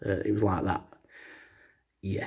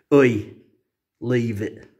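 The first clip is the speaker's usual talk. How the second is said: mock warning; 4 dB louder, much slower than usual. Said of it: "She said it with a deeper voice than that".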